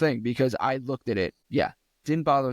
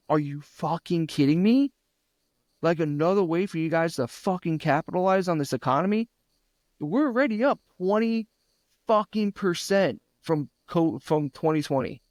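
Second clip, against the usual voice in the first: pitched way up, getting worked up and indignant; heated rant; 5 semitones higher than usual. Retold or imitated. Podcast host ranting about inflation to his friend, heated and high. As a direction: vent your outrage at price hikes.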